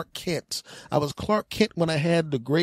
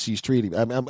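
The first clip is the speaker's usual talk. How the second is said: ordinary talk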